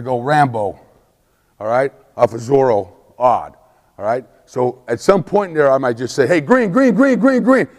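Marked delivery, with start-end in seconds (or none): 6.3-7.7 high repeated green call. Retold or imitated. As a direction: ring out the play. Calling play at practice.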